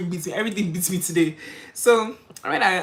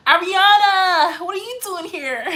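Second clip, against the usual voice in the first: drawn-out delighted high call of the name; excited greeting; 12 semitones higher, 9 dB louder, slower than usual. Real, not acted. Streamer greets returning viewer Ariana in chat, thrilled high call. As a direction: stretch the name out, thrilled to see her.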